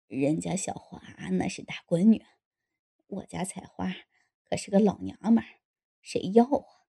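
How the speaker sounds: low, halting man's voice; hesitant asking. A narrator's reading. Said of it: "他吞吞吐吐地问". (吞吞吐吐，低声地问)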